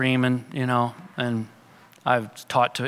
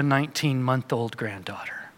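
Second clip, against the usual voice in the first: soft whisper; 3 semitones lower, 6 dB quieter than usual. Real, not acted. Whispered tender punchline.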